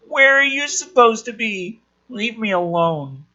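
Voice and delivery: put-on a small kid begging to be left alone; lightly voiced scared kid. Rory pleads with the bullies to leave him alone.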